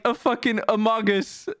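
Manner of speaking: ordinary talk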